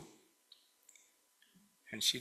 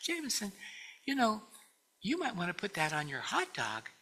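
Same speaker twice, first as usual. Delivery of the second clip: put-on high, gentle coaxing to a toddler; sweet voice to a child; 6 semitones higher than usual. Grandmother coaxing a toddler about ketchup, sweetly. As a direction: coax the little boy kindly, lightly imitated. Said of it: "beautiful way of talking to little children".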